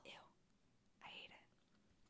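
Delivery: barely audible whisper; creeped-out whisper. Real, not acted. Host reacts to the whispered confession, creeped-out whisper. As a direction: whisper it slowly, no voice.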